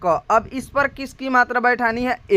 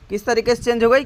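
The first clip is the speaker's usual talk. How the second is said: ordinary delivery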